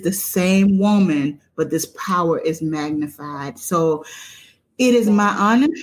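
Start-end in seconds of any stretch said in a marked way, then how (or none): 4.8-5.7 louder, lifted announcement of an honor; excited build-up. Real, not acted. Host announcing her cousin as a guest, louder and lifted. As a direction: ramp up the joy before the big reveal.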